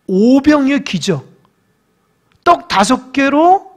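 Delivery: usual speaking voice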